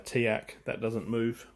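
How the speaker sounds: ordinary delivery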